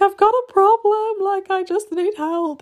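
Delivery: high-pitched, drawn-out mock wail; mock sobbing. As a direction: imitate yourself sobbing for help. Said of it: "I was, like, sobbing".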